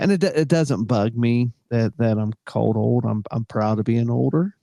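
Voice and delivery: usual speaking voice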